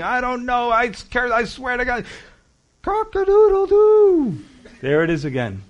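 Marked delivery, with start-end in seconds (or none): 0.0-2.1 high, frantic denial, I swear to God; Peter swearing. Acted. Peter cornered by the crowd, swearing in a high frantic voice. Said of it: "he starts to curse and swear". 2.8-4.4 very high, loud, drawn-out cock-a-doodle-doo; rooster crow. Acted. Preacher acts the rooster crowing at Peter's denial. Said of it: "the rooster crowed".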